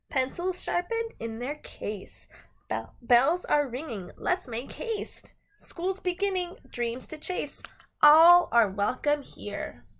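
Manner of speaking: bright, sing-song picture book reading; reading to children. Acted. Teacher reading a rhyming book to her class, sing-song. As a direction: read the rhyme to a class, lilting and lively.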